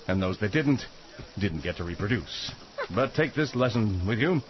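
grave narrator read; mock serious newsreel voice; documentary narration.

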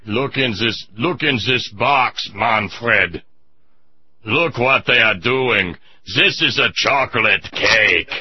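slow, stern German tank commander; mock German accent, drawn out; German officer accent. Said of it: "and he says".

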